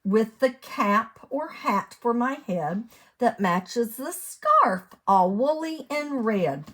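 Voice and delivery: sing-song storybook read; lilting picture-book reading; reading to children. Acted. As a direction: read the rhyme out to little ones.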